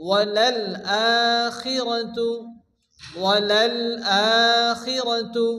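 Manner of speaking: ওয়ালাল আখিরাতু with a long opening "aa" on the second word; measured tajweed ওয়ালাল আখিরাতু, drawn-out "aa"; elongated vowel. Shown as a demonstration. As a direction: hold the first vowel of আখিরাতু. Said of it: "এক আলিফ পরিমাণ টান দিয়ে পড়তে হবে".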